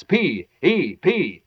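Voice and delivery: slow, letter by letter; spelled out slowly. Acted. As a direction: spell each letter deliberately.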